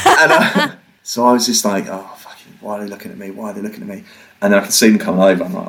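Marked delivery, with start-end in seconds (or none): 1.9-4.0 whispered, anxious inner voice; hushed panic about being watched; paranoid whisper. Retold or imitated. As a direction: whisper it like you fear being overheard.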